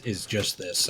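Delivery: ordinary talk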